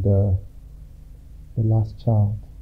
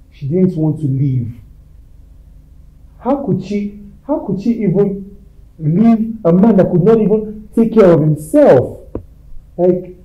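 higher, louder indignant rant; angry; 6 semitones higher, 5 dB louder than usual. Acted. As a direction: build into an angry outburst. Furious outburst about mother.

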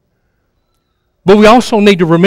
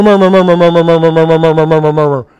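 mock murmuring; 3 semitones lower, faster than usual. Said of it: "and you start murmur".

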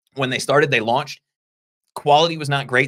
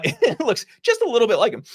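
laughing, higher-pitched amused remark; 10 semitones higher than usual. Real, not acted. Host joking to co-host about a lookalike, amused laughing. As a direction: talk through a chuckle, higher.

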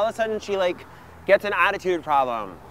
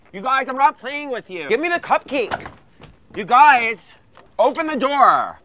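scolding; 6 semitones higher, 3 dB louder than usual. Acted. Yelling at brothers locked in.

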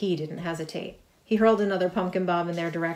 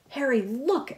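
higher, eager cry; excited cry; 7 semitones higher than usual. A narrator's reading. MJ spotting the heroes, crying out excitedly. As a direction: call a friend to look. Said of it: "M J cried".

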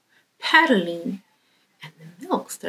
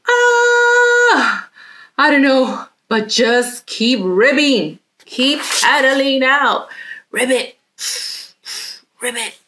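frog urging on; 6 semitones higher, 6 dB louder, slower than usual. Acted. Frog urging friend to paddle.

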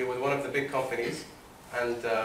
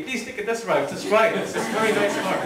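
higher, louder pleading salesperson; retold begging, urging the listener to give it a go; 6 semitones higher, 3 dB louder than usual. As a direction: plead eagerly, as a retold plea. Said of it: "she's, like, begging me".

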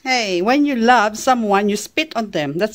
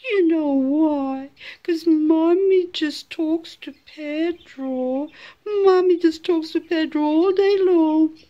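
fun talk; 5 semitones higher, slower than usual. Real, not acted. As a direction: draw the words out dotingly for a pet. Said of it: "you like fun talk".

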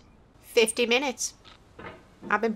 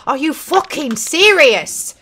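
exasperated outburst; 4 semitones higher, 7 dB louder, slower than usual. Real, not acted. Disbelief repeated louder.

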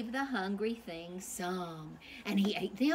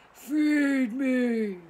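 big booming monster voice, much louder; hungry monster demand; 4 semitones higher, 9 dB louder than usual. Acted. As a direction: boom it out like a starving beast. Hungry monster points to sign.

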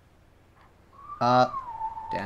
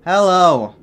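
loud greeting; 4 semitones higher, 8 dB louder than usual. Pretend. Calling out.

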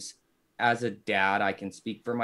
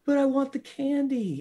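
put-on high pleading kid whine; whiny child; 14 semitones higher, 4 dB louder than usual. Dad imitating his son begging for candy, high whine. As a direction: beg for candy like a bawling toddler. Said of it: "He's like".

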